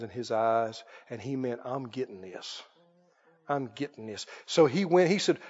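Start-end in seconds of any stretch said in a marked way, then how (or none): 1.6-4.3 very low, very soft to oneself; acted private resolve, hushed; greedy aside. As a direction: covet it under your breath. Greedy Balaam voiced to himself.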